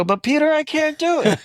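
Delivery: high, strained mock whine; pleading with his agent in a high mock whine; exasperated plea. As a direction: whine it up high like a frustrated actor.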